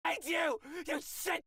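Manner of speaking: high furious shout; shrieking rage. Acted. Beaten cartoon screams revenge at the player.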